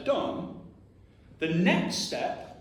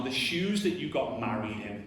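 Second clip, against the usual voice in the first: ordinary talk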